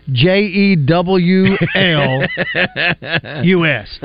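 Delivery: slow, letter by letter; mock spelling out. Shown as a demonstration. Co-host jokingly justifying how he says Julius, mock spelling out. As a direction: spell it out with pauses between letters. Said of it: "pronouncing it like it's spelled".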